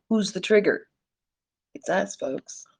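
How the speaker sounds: ordinary talk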